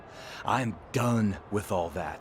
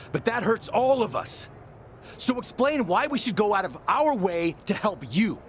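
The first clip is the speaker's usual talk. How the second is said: higher, pushing heated retort; heated challenge; 8 semitones higher than usual. Acted. Former partner turning on the champion, heated. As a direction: throw it back at him, rising.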